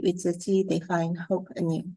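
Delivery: ordinary talk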